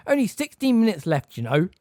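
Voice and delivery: high, loud fretful reminder; anxiously. A narrator's reading. Examiner reminding distracted students, anxious. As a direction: nervously hurry them back to work. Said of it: "said Professor Tofty anxiously".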